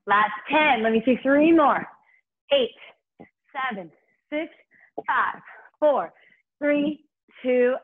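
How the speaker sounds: loud punchy countdown; aerobics instructor. Acted. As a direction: call out each number to push.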